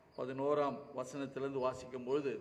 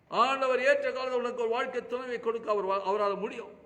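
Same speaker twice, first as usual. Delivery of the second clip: impassioned preaching; 9 semitones higher, 8 dB louder than usual. Acted. Preacher to congregation about the widow, full-force preaching. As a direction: lift your voice as a preacher crying out for the widow.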